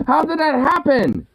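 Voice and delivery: high, incredulous outburst; baffled and half laughing at it; disbelief. Real, not acted. Still baffled by handlebar.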